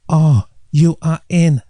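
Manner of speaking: put-on slow, drawn-out Chinese accent, mystical tone; Chinese accent impression. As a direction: imitate the acupuncturist's Chinese accent, slowly. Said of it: "that's a very good impression".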